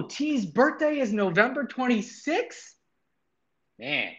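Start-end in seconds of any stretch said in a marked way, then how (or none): 0.0-2.8 high, slow, puzzled question; surprised. Real, not acted. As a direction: read it out with surprise. Reading a surprise in chat.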